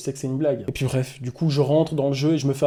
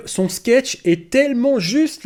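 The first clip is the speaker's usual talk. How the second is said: drawn out praise; 7 semitones higher, 3 dB louder, much slower than usual. Acted. Praising sketch sarcastically.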